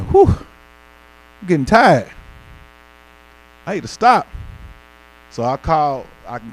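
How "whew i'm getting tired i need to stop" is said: higher, slow, loud weary groan; drawn-out tired sigh at the wheel. Retold or imitated. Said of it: "I start saying".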